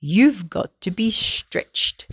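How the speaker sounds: very slow, ominous reveal; drawn-out pronouncement. A narrator's reading. Mr Twit revealing the cure to his wife, slowly drawn out. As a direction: stretch it out word by word.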